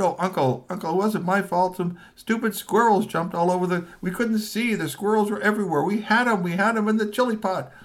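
pleading nephew. Acted. Kashi pleading with angry uncle Kamakura-san, high whiny excuses. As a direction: beg your way out of blame. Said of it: "Kashi said".

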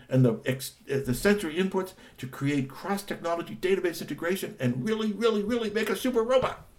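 gushing, high and breathless with excitement; thrilled character going on and on; excited high voice. Acted. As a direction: rattle it off like an overeager kid. Excited character gushing about robot.

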